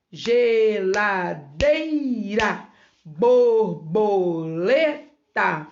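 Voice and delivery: slow list of words, every syllable stretched; bi-ci-cle-ta, e-le-fan-te, beat by beat through the list; split into syllables. Shown as a demonstration. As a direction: draw out each word for young learners. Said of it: "quatro ou mais sílabas".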